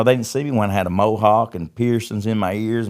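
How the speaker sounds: usual speaking voice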